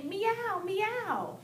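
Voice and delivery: mother imitating her son at the window; toddler son. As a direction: imitate a toddler calling a dog meow. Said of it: "and he'll say".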